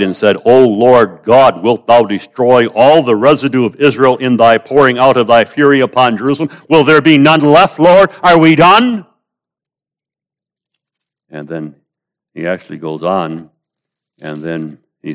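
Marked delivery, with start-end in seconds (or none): 0.0-9.1 anguished cry. Acted. Ezekiel cries out to God over Israel, loud pleading. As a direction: cry out to God begging for mercy. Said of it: "fell upon my face and cried".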